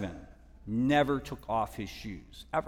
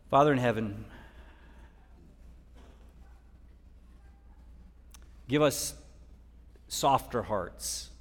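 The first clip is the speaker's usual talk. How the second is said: prayer. Real, not acted. Leads closing prayer.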